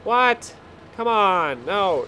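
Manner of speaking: high, loud disbelieving outburst; startled frustration. Real, not acted. Gamer reacting to a glitch in disbelief. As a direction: bark it out in shocked annoyance.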